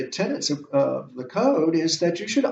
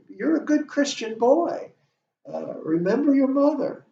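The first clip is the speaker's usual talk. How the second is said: high-pitched, coaxing mother's appeal; lightly acted maternal plea in a higher voice; motherly reminder; 9 semitones higher than usual. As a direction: gently remind a son, pitched up. Voicing mother's appeal to Hamlet.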